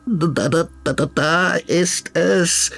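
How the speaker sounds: very high, stammering frog voice; frightened croak. Acted. Frog stammering fearfully, pointing at the monster. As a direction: stammer in fear. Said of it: "quakt Filippo ängstlich".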